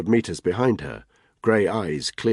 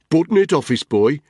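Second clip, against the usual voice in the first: louder, sharp snap; angry snap; 10 semitones higher, 8 dB louder than usual. Acted. Tony snaps at Spud, angry and loud. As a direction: shut him down hard. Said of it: "he snapped".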